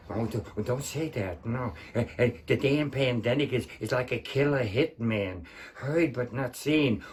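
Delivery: low, soft movie-creep impression; Peter Lorre. Second movie star interrupts the pandemic poem, low hushed impression. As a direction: drop into a hushed, hesitant character voice. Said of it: "Then going to Peter Lorre".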